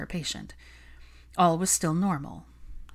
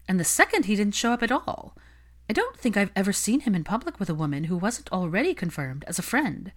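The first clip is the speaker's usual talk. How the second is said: higher, fishing gossip voice; higher, nosy socialite complaining about her marriage dates; prying society gossip; 3 semitones higher than usual. Acted. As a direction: dig for dirt while sounding sweet. Olivia gossips about Draco.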